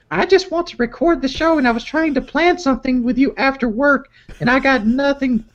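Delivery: put-on high falsetto girlfriend voice reading texts; girly voice. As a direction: read her lines in a squeaky girly voice. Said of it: "Could you do a, a girly voice for this part?".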